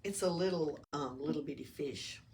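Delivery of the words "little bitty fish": usual speaking voice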